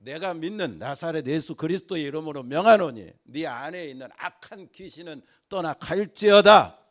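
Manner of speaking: Paul shouting at the possessed woman to drive the spirit out; Paul's exorcism command. Acted. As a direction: cast the demon out with full authority. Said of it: "바울이 그 점치는 귀신 들린 그 여자를 향해서".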